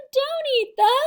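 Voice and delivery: high, loud frantic cry; panicked yell cut off. A narrator's reading. Children shrieking as Sammy bites the teacher, high and loud. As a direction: cry out and break off. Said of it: "yelled the children".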